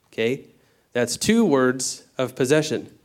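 ordinary talk

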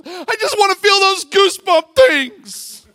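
high, loud, drawn-out mock sob; mock wailing. Man at the altar answers the preacher, hammed-up wailing. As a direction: wail it like an overwrought seeker. Mimics man craving goosebumps.

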